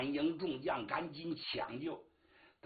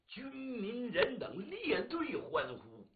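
drawn-out narration; 6 semitones higher, slower than usual. A narrator's reading. Stretched narration of cheering crowds.

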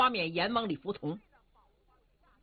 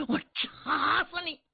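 vengeful threat; 6 semitones higher, slower than usual. Acted. Son charges his father's killer.

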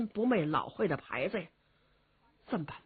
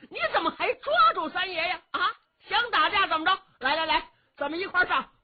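rally the others to fight him; old men up in arms, ready to brawl; agitated elders; 8 semitones higher, faster than usual. Acted. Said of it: "这些老人全都急了".

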